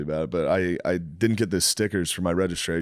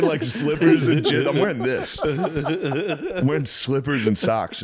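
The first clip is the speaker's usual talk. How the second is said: higher, slow, laughing; laughing at himself; 6 semitones higher, slower than usual. Real, not acted. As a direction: giggle at your own outfit.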